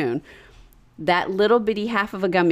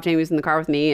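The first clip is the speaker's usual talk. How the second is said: normal talking voice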